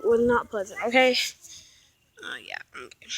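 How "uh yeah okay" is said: hoarse sore throat. Real, not acted. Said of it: "my throat hurts really bad".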